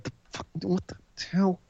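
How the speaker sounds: barely audible imitated muttering; hushed disbelief. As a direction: say it way under your breath, as if mouthing it. Mouthing Randy's glance at her.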